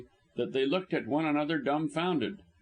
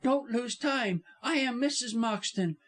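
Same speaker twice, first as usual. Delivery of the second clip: high, urgent wife; distraught, high-voiced urging; impatient; 10 semitones higher than usual. A narrator's reading. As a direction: press him insistently in a raised pitch. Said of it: "she answered impatiently".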